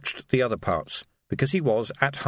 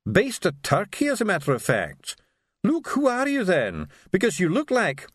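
fussy doctor voice; 8 semitones higher than usual. A narrator's reading. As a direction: play the startled doctor, pitched up.

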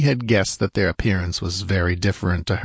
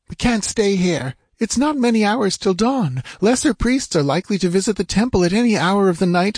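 high, anxious female character voice; urgent woman; 11 semitones higher than usual. Acted. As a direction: urge him to flee before discovery. Woman urges escape.

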